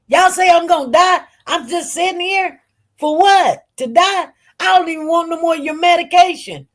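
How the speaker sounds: rising challenge, then a rushed refusal; indignant protest. Retold or imitated. Indignant retort retold from hospital.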